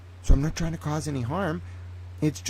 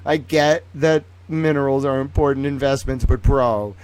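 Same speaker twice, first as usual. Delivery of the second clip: ordinary delivery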